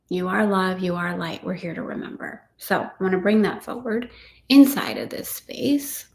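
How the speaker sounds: normal talking voice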